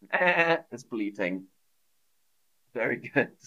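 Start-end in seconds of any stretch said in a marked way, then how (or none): 0.1-0.6 short demonstrated bleat; imitated sheep bleat. Shown as a demonstration. Teacher showing what bleating means, imitating a sheep. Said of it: "Bleating is the noise that sheep make".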